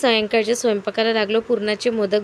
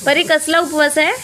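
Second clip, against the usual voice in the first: higher and louder on कसला; raised repeat; 5 semitones higher, 5 dB louder than usual. Real, not acted. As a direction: call the question out again to her. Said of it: "repeated louder".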